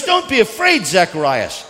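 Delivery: loud, high proclamation; forceful cry, dropping on the name; preached angel's call. Acted. Same angel line, preached in own accent.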